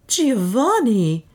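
high, drawn-out cry of his name; high, stretched exclamation; startled exclamation. A narrator's reading. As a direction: call out the name in shock, falling away. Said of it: "exclaimed Beatrice".

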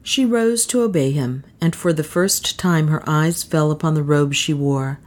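ordinary delivery

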